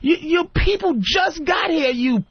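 mock outraged comeback; exasperated retort. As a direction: yell it at someone who just arrived. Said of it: "And I'm like".

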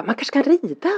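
high, hopeful child's suggestion, retold; Svante. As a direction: lightly mimic a kid pitching an idea. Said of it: "han bara".

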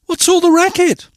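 high, loud indignant call; piercing startled demand; crying out. A narrator's reading. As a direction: cry out in a high-pitched girlish voice. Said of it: "Cindy cried".

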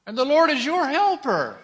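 high, loud proclamation; preacher proclaiming to the church; loud declaration. Real, not acted. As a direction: declare it over the crowd.

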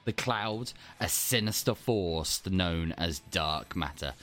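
story narration. Acted. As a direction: tell a space fairy tale, hushed and grave. Story time with [name].

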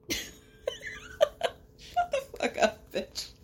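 cracking up mid-insult; laughing. Real, not acted. Recapper laughing at Cody's overdramatic claim, laughing.

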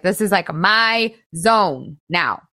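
usual speaking voice

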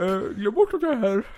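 high, put-on character voice; high, odd disembodied voice; voice in the dark. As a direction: play the unseen guard in a high voice. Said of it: "du hör hans röst i mörkret".

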